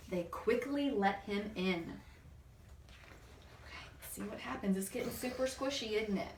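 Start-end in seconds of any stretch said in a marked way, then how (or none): none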